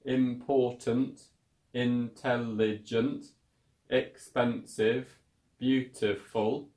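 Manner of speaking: important, intelligent, expensive, beautiful drawn out slowly so each syllable is heard; slow teacher demo of important, intelligent, expensive, beautiful with every syllable clear. Shown as a demonstration. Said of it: "it has three syllables".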